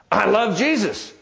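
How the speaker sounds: acted indignant insistence, pitched high and drawn out; mad protest. Bob insisting to his doubting sister, mad protest. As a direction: protest it slowly, high-voiced, as a riled man. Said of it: "He's really mad".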